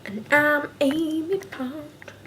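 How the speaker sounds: high, slow, loud character voice; drawn-out, hammed-up character introduction; Amy Pond.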